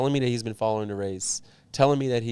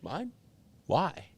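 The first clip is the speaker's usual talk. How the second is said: surprised disbelief; 6 semitones higher, 4 dB quieter than usual. Retold or imitated. Surprised others want his endorsement.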